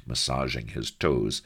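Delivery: ordinary delivery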